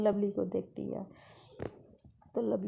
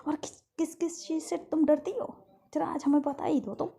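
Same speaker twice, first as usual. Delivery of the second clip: higher, louder teasing; teasing challenge; 7 semitones higher, 4 dB louder than usual. Acted. Caretaker teasing the girl while the nurse sneaks up, playful. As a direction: needle her playfully. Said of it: "तो कहती".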